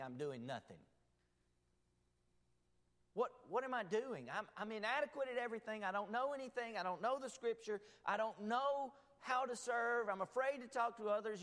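lightly voiced worried believer; doubting believer. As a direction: voice the fretful churchgoer.

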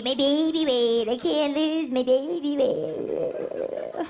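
drawn-out whine. Retold or imitated. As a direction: drag the moaning out to nothing. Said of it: "they're like".

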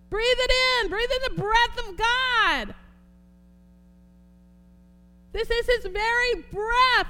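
fiery preacher exalting the breath of God; full-force preaching. Acted. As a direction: pour it out over the congregation.

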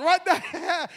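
laughing through the words. Real, not acted. Pastor laughs at his own joke with the church, laughing. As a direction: chuckle at your own joke.

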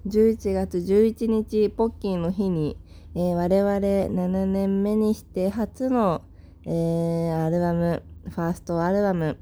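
normal talking voice

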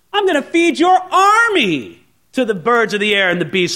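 high, loud, mounting challenge; defiant David. Acted. David answering Goliath's threat, defiant and rising. Preacher voices David's retort.

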